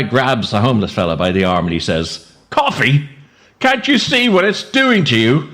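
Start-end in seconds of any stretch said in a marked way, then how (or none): 2.5-5.5 hammed-up scolding shout with a laugh; mock outraged shout. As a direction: bellow the punchline half laughing. Joke punchline, guy shouting.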